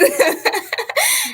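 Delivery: high embarrassed giggle; a real high giggle; high laugh. Real, not acted. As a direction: giggle at yourself. Laughing at own course change.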